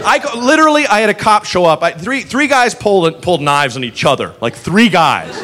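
ordinary delivery

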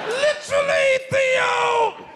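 very high, hammed-up sitcom dad shout; badly faked impression, drawn high; Bill Cosby. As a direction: holler it in an exaggerated put-on voice. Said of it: "Sounds like Bill Cosby a little bit".

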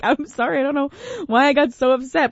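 lightly voiced sorry; apologetic. Host apologising to wife, high sheepish. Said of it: "I was like".

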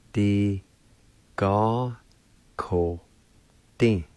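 "D Ga, ko, din" broken into separate spaced syllables. Shown as a demonstration. Teacher sounding out a Cherokee word, syllable by syllable. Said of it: "is pronounced like this".

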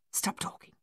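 voiceless, very soft whisper; hushed whisper. A narrator's reading. Soldier hushing his mates by the pond, whispered. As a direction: whisper it to silence the others.

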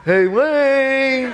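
high, drawn-out call through a door; pestering late-night holler, high and held long; Mike calling out. Acted. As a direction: call the name in a loud, stretched singsong. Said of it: "then I hear this voice".

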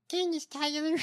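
high-pitched mock politician voice; imitated odd voice. Co-host tries the name in politician's voice, high mocking impression. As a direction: say the name way up high, mimicking her. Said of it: "With that voice?".

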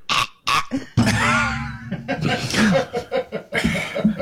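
repeated airless gagging gasps; strangled mock gasps; imitated choking. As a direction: act out choking while being squeezed. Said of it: "And I'm like".

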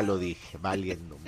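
"valiendo" casi en voz baja; suéltalo bajito, resignado; murmullo apenado. Real, not acted. Se le escapa una marca. (admite el error en voz baja)

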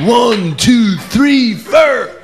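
high, punched count, "four" said like "fur"; "four" to rhyme with "fur", shouted high; mocking rock count-in. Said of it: "Bon Jovi doesn't go on tour".

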